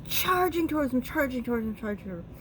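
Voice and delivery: high burst tumbling down and fading away; breathless-sounding repeats that trail off; excited charge. Acted. Bull's charge acted out in story.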